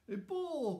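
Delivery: put-on high, bright child's delight; delighted cry. A narrator's reading. Boy thrilled by his father's wooden toy, high excited cry. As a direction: squeal over the new toy. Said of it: "cried Pablo".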